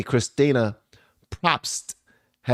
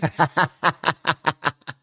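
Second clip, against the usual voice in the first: high cackling burst of laughter; helpless laughter; 4 semitones higher than usual. Real, not acted. Vlogger cracking up at the phrase she just heard, helpless laughter.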